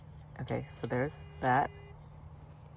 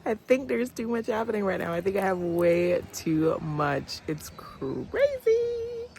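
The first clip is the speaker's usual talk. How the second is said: exasperated; 8 semitones higher, 4 dB louder than usual. Real, not acted. Repeats higher, exasperated.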